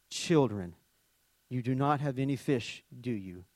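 Jesus gently calling the disciples, soft and low; gentle, loving call. Acted. Jesus calling fishermen from the beach, tender and low. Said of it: "lovingly and gently, he calls".

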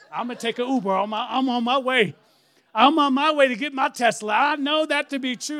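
rising, loud, eager rush of words; excited rush. Retold or imitated. Preacher telling his wife he's off to get the Tesla, excited and loud. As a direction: tell it like you can't wait to go.

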